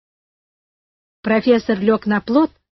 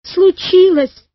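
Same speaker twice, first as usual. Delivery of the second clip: high, drawn-out tearful wail; narrated sob, voice pitched up and stretched; sobbing; 7 semitones higher, 4 dB louder, much slower than usual. A narrator's reading. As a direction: wail it like a crying boy. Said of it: "всхлипнул Карик".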